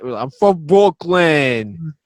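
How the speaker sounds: loud, slow, high mock New York accent; mocking rough New York accents, New York accent. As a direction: ham up a tough New York local. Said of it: "rough New York accents like that".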